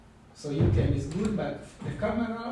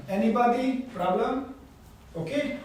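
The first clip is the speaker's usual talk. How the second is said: louder, higher checks to the whole class; calling out to the room; 8 semitones higher, 5 dB louder than usual. Real, not acted. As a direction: raise your voice to ask if everyone is fine.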